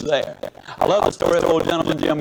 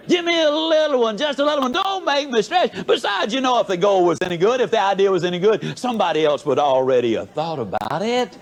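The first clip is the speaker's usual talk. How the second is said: higher, begging mock whine that builds; whiny plea; 7 semitones higher than usual. Speaker mocks people begging God for small goals, high whine. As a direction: plead to be let off from stretching. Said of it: "we say".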